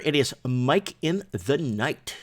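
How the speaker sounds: slow, drawn-out show title; show intro. Acted. Host opening his late-night show, slow announcer voice. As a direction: announce the show's name slowly.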